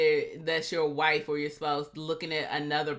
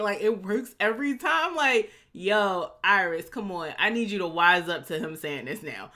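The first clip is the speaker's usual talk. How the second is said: ordinary delivery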